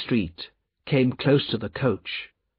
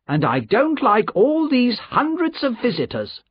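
high-pitched, indignant complaint; high-voiced cross companion; cross guardian; 11 semitones higher than usual. A narrator's reading. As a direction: grumble in a high, exaggerated character voice.

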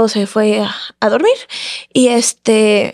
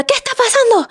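imitated shout; 12 semitones higher than usual. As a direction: imitate someone calling down from upstairs in a much higher voice. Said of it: "tiene una voz bien fuerte, entonces grita".

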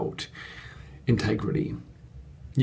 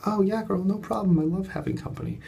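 much higher, gushing mock-cheerful yes; eager yes; 10 semitones higher than usual. Host agreeing to take a guest, gushing. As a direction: sing it out like an eager hostess. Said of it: "And I was like".

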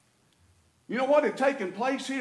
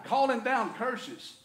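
ordinary talk, nothing marked

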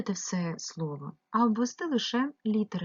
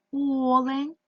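"Олень" with a long, higher first "О"; stress on first syllable; 5 semitones higher, 4 dB louder than usual. Shown as a demonstration. Teacher checking for unstressed vowels, stressing the first syllable. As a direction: stretch the opening vowel of "Олень". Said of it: "не наголошений".

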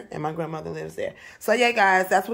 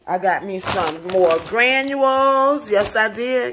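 proud excited; 3 semitones higher, 5 dB louder, slower than usual. Real, not acted. Proudly showing purchase.

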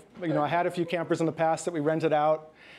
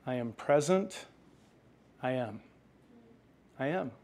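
soft reassurance; 4 semitones lower, 5 dB quieter than usual. Acted. God speaking reassuringly, softly.

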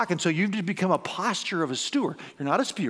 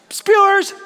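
very high loud crowd call; 12 semitones higher, 9 dB louder than usual. Real, not acted. Preacher polling the congregation again, high loud call. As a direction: belt it to the room.

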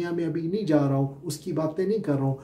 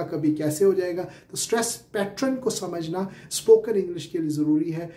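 normal talking voice

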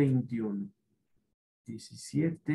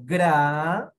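gra said loud and crisp; loud model syllable; 7 dB louder than usual. Shown as a demonstration. Teacher shows the class how gra sounds, loud. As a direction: belt out gra for the kids to copy. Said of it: "Repita el sonido".

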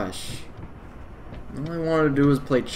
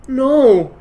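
high loud frightened no; scared cry; 10 semitones higher, 10 dB louder than usual. Real, not acted. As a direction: cry out in fear.

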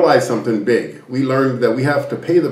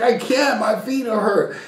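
mock whiny protest; 10 semitones higher, slower than usual. Man in flippers to those yelling at him, mock whiny protest. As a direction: plead in a high voice, hammed up. Acting out his helpless protest.

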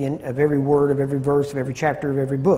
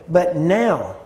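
"now" drawn out with a falling tone; stress on NOW; 2 semitones higher than usual. Shown as a demonstration. Teacher re-reading a verse to show shifting emphasis, stress on "now". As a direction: lean on the word "now". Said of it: "putting emphasis on different words".

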